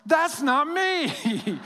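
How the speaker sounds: slow, high, drawn-out dismay; stunned disbelief. Retold or imitated. Seeing his own photo, slow high dismay. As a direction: let the words hang in slow disbelief. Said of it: "I go".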